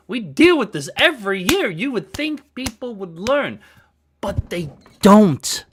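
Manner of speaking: loud, heated ranting at the crowd; real frustration boiling over into shouting; furious New Yorker venting loudly; angry rant. Real, not acted. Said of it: "It makes me furious".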